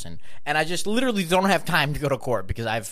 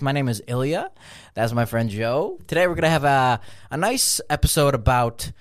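usual speaking voice

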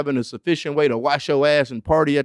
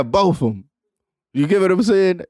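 ordinary talk, nothing marked

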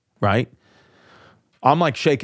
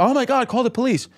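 panicky mother; 10 semitones higher than usual. Retold or imitated. Mother panicking in imagined reply.

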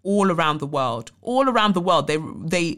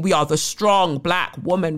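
ordinary delivery

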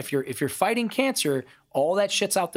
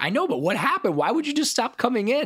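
eager question; 11 semitones higher, 3 dB louder than usual. Retold or imitated. Asking Anne why she vanished.

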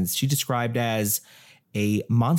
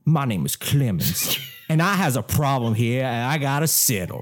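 bad Southern accent. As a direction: do a deliberately corny Southern ghost accent. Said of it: "Should I do an accent?".

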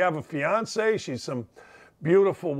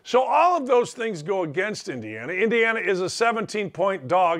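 usual speaking voice

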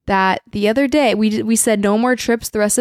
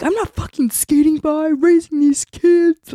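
indignant retort; 8 semitones higher than usual. Retold or imitated. Partner acting out a spouse's comeback in a money fight, indignant. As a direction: throw the accusation back, voice pitched way up.

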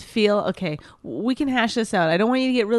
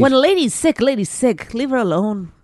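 a put-on old-movie lady with a touch of pirate; forties lady; 3 semitones higher than usual. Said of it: "do your forties lady".

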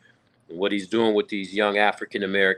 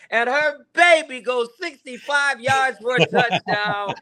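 much higher, slowed excited touchdown call; play-by-play call; 14 semitones higher, 4 dB louder, slower than usual. Retold or imitated. Sportscaster reclaims the mic to finish the call, excited high call. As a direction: take the mic back and finish the call big. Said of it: "grabbed the mic back from her and said".

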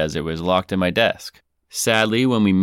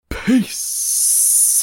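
sign-off. Pretend.

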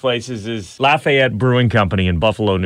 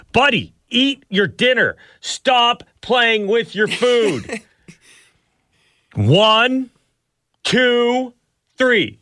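stern parent counting; 11 semitones higher, slower than usual. Retold or imitated. Parent voiced counting at son.

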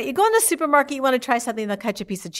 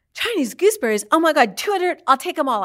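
excited; 7 semitones higher, 4 dB louder than usual. Retold or imitated. Frida hears about two hundred boxes.

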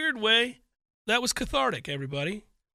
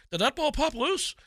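high, hushed hopeful voice; imitated hopefulness; 6 semitones higher, 4 dB quieter than usual. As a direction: mimic a guy wishing for good news. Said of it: "That hopefulness in a guy's voice".

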